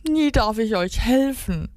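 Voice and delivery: high, hammed-up wail of complaint; wailing duck. A narrator's reading. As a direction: wail it, feeling left out. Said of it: "heulte die Ente".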